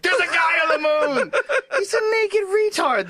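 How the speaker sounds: high, loud pretend alarm; imagined astronaut yelling in discovery; mock discovery shout. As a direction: shout the find in hammed-up amazement. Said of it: "you'd be like".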